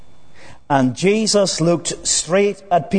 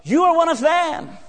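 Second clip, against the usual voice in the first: a touch higher, pointed accusation; lightly voiced accuser; 8 semitones higher than usual. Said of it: "someone else comes up".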